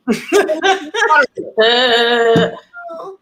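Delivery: high burst of laughter; big laugh. Real, not acted. Comic laughing about still doing Zoom shows, high burst. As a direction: cracks up at his own line.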